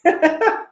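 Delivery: very high giggle; high tittering ha-ha; demonstrated laugh. Shown as a demonstration. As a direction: put on a high-pitched laugh. Demonstrates forced phone laughter.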